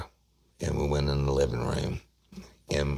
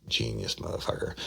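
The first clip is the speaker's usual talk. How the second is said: sarcastic aside; 3 semitones lower, 6 dB quieter than usual. Retold or imitated. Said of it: "I'm like".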